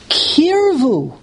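single word held up high, then falling; emphatic citation. Shown as a demonstration. Teacher quoting the verse's unique word to listeners, emphatic. Reads out word with unusual trop.

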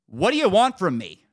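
loud, high exasperated demand; retold outburst, patience used up. Patient snaps at the silently waiting provider, loud and irritated. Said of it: "I'm getting more irritated".

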